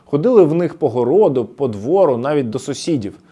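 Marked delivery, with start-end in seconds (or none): none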